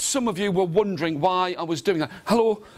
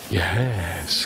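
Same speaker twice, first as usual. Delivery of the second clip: very low drawn-out yes; Brahmin; 12 semitones lower than usual. Acted. Brahmin agrees to the beggar's offer, very low. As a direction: drop low and stretch the answer. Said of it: "The Brahmin said".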